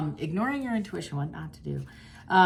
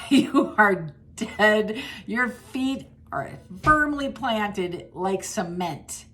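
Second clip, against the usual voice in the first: laughing; 6 semitones higher, 6 dB louder, much slower than usual. Real, not acted. Laughing while telling them stuck.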